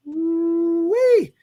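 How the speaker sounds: high excited ooh-wee; excited whoop. Real, not acted. Host whooping at a huge contract figure, excited. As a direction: whoop at the big number.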